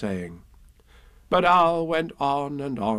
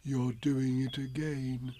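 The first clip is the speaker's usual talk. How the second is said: soft, slow, dejected Pooh; sad; 10 dB quieter, slower than usual. A narrator's reading. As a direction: say it sadly and quietly, dragging a little. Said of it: "said Pooh sadly".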